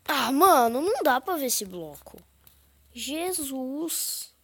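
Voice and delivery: frustrated yell. Real, not acted. Frustrated, can't see the block.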